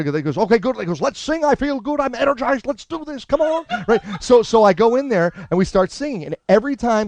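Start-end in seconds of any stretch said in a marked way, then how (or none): 0.4-0.8 high, decisive approval; brisk comic impression; Albert Brooks. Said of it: "I actually do an impression of him". 1.0-3.6 very high, energized pep talk; rally everyone in his keyed-up voice; excitable comic impression, pitched way up; Albert Brooks. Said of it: "I actually do an impression of him".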